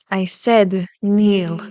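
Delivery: slow, loud, every word weighted; slow menacing command. Acted. Villain repeating kneel to the unheeding hunter, slow and menacing. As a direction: repeat the order like a final warning.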